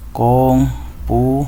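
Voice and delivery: slow, separated com-pu-ta-do-ra; syllable by syllable. Shown as a demonstration. Teacher dividing a word for pupils, slowly syllable by syllable. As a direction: break the word into five syllables with clear gaps. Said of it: "las dividimos por sílaba".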